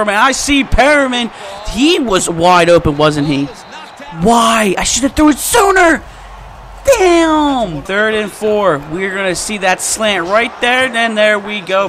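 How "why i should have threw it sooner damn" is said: loud, high-pitched self-reproach; frustrated raised voice; exasperated. Real, not acted. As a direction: shout your regret, pitch jumping up. Regrets a late throw.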